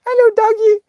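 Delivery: put-on high sing-song "Hello, doggy" like a little kid; sweet child voice. Child greeting a strange dog, high and sweet. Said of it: "I go and say".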